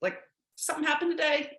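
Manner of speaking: gentle check-in. Retold or imitated. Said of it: "knock on the door, like".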